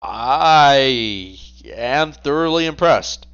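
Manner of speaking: very slow, savoured praise; slow impressed drawl. Acted. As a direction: stretch each word out, mock-impressed. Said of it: "Gabriel looks to you and says".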